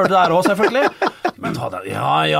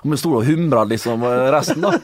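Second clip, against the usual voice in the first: normal talking voice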